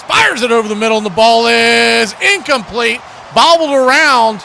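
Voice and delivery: radio announcer calling a broken play with rising excitement; excited play call. Real, not acted. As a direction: call the bobbled ball with real excitement. Live call of incomplete pass.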